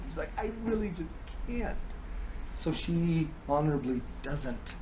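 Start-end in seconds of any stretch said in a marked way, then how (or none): 0.4-1.8 small, high, soft voice; reluctant refusal. Acted. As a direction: refuse meekly, very quietly. She reluctantly refuses Odin.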